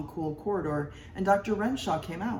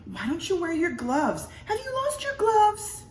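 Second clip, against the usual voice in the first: much higher, louder bossy wife; 14 semitones higher, 3 dB louder than usual. A narrator's reading. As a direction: order him about like a busy wife. Wife nagging about gloves.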